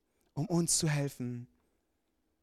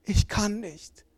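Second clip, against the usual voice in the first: put-on tearful child; 6 semitones higher, much slower than usual. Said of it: "fängt an zu weinen".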